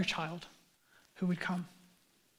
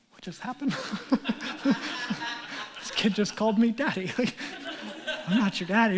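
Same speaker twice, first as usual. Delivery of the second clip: laughing through the words; amused disbelief; 3 semitones higher than usual. Retold or imitated. Dad telling of neighbor kid calling him daddy, laughing. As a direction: crack up while retelling your bewildered reaction.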